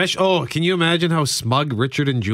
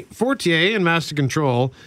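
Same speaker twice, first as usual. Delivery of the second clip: usual speaking voice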